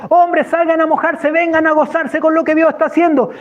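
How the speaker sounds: high, ringing summons; rally everyone out to celebrate; exuberant calling out. Retold or imitated. Man calling the men out into the rain, exuberant holler.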